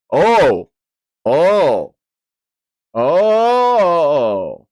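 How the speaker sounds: high, loud rising cries of excitement; real hype at the plot twist; excited oh. Real, not acted. Streamer reacting to the twist, loud excited cries.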